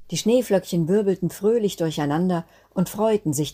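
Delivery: plain reading voice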